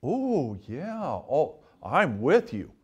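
soft, hushed mock agreement; lightly voiced naive follower; gullible agreeing reader. Preacher mocking readers who nod along. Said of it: "people will read that and say".

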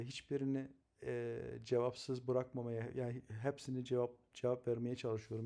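ordinary talk

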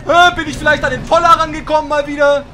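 high, loud annoyed question; loud frustrated. Real, not acted. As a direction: complain loudly at the game. Frustrated at game.

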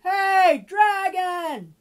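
high, very loud yell; full-volume shout. Acted. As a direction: shout as loud as you can. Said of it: "shouted as loud as she could".

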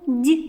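Д as palatalized "d'"; soft Д, like "dyi"; soft consonant. Shown as a demonstration. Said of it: "М'який".

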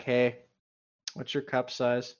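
ordinary talk

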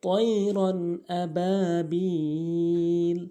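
slow, melodic tajweed chant; Quran recitation. Shown as a demonstration. Teacher reciting the first verse for learners, measured Quran recitation. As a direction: recite with long, held vowels.

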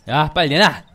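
loud robber demand; shouting threat. Pretend. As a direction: yell at the shopkeeper. Robbing shop, threatening owner.

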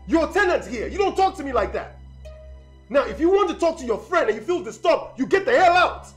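high, heated dressing-down; angry rebuke. Acted. Landlord putting his tenant in her place, angry and heated. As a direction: pull rank, voice climbing in fury.

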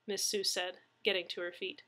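ordinary delivery

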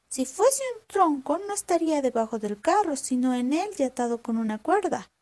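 voz de zorra aguda y viva, más alta; zorra astuta. Acted. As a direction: una zorra lista que pregunta con curiosidad. (la zorra pregunta al campesino asustado, voz aguda y curiosa)

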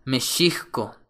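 "Mexicco" said me-SHEE-ko; careful "Mexicco" as meh-SHIH-ko; original pronunciation. Shown as a demonstration. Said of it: "originalmente se escribe y se pronuncia".

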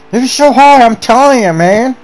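venting how hard it was; exasperated. Real, not acted. Looking back on tough race.

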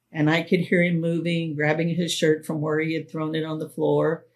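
usual speaking voice

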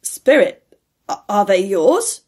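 loud start, stumbling into the question; appalled, halting question. A narrator's reading. Appalled Scrooge asks the spirit about the children, halting. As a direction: falter as if the words choke you. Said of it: "but the words choked themselves".

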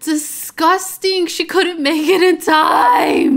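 slow, high, loud wail of disgust; horrified yell. Real, not acted. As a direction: wail it out in comic dismay. Horrified at character's bathroom accident.

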